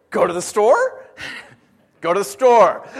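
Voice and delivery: put-on high, loud, giggly little-girl asking; toddler's voice with laughter. Pastor imitates toddler asking to go shopping, giggling. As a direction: laugh through it like his small daughter. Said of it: "her response is".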